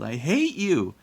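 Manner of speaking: put-on high, loud, wailing child's yell; howling. A narrator's reading. Sobbing boy lashes out at the woman, howling. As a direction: howl it at full pitch. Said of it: "he howled".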